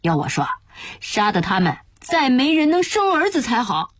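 indignant outburst climbing in pitch; vengeful cursing. Acted. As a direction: spit out a bitter threat, rushing. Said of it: "突然咬牙切齿地骂道".

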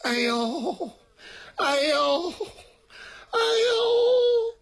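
long, rising high-pitched groans; acted pained moaning. A narrator's reading. Beaten man daring to groan once attackers are gone, moaning in pain. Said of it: "这才敢哼哼出声来".

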